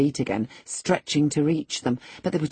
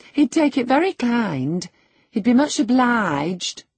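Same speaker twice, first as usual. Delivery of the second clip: high-pitched, courteous coaxing; polite, high-pitched appeal; polite request; 8 semitones higher, 4 dB louder than usual. A narrator's reading. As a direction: ask deferentially in a raised, lilting voice. Housekeeper politely relays request to lady.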